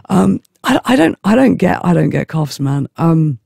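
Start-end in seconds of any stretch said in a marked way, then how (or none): none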